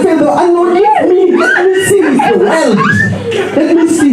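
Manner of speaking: high-pitched, comically desperate cry; mock pleading. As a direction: plead in hammed-up protest, voice way up. Acted plea while eyes are covered.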